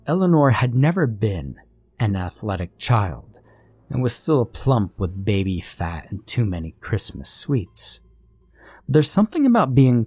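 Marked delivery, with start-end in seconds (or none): none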